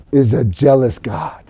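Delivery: ordinary talk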